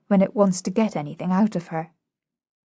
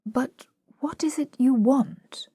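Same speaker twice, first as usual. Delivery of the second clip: gentle; 6 semitones higher, slower than usual. A narrator's reading. Pickering to the frightened flower girl, gentle. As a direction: ask it gently, unhurried.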